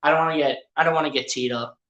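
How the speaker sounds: low mumbled imitation of giving up; mock resigned player. Commentator imitates player backing off the ref, mock resigned. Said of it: "He's like".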